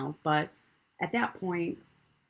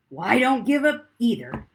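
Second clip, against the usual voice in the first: firm declaration; 4 semitones higher, 9 dB louder than usual. Retold or imitated. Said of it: "And I followed it up with".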